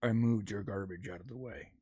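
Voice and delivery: low, soft neighbor imitation; deep, understated grudging remark; passive-aggressive neighbor. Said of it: "he does this passive aggressive thing".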